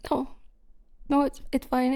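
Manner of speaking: usual speaking voice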